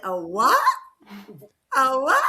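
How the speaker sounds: loud stunned repeat, rising; retelling a friend's loud shocked question; surprised. Retold or imitated. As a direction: blurt it out loud in disbelief. Quoting a stunned reply.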